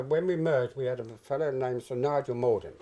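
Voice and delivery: normal talking voice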